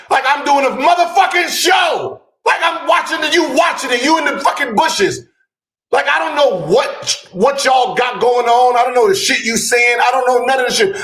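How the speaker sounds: high-pitched, incredulous outburst; exasperated rant. Real, not acted. Host protesting texts received during the show, high and exasperated. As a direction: complain in a high, strained, exasperated shout. Heated rant about being texted mid-show.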